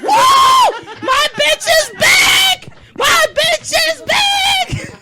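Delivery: high, loud, slow shouting dog; overexcited dog. Retold or imitated. Dog spotting the husband, high slow shout of delight. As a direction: bellow it like he's found his true love.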